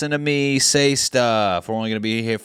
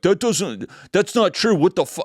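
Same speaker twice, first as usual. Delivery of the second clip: high, indignant sputter of an angry fan; imitating an offended viewer losing it; mock angry commenter; 8 semitones higher, 3 dB louder than usual. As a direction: play the furious commenter, hammed-up. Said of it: "like, getting visibly mad".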